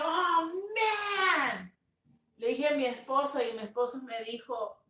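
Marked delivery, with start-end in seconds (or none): 0.0-1.7 high-pitched, stretched "oh man"; a drawn-out cry of mock dismay; dismayed exclamation. Painter telling of paint starting to drip, dismayed exclamation.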